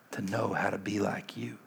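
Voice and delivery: a soft breathless whisper. Acted. Peter vowing to follow Jesus, whispered. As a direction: let the last words fall to a whisper. Peter's surrender, hushed climax.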